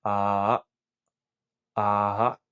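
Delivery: "Ah" chopped by closing the throat; glottal closure. Shown as a demonstration. Teacher showing how the glottis closes, "Ah" with a throat stop. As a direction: say "Ah" and deliberately shut the glottis. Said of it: "pronuncia el sonido de la A e intenta cerrar".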